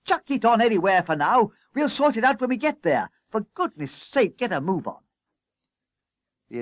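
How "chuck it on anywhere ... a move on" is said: high, frantic orders; harassed, high-pitched bossing; urging on. A narrator's reading. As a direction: chivvy them to load up. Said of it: "he encouraged them".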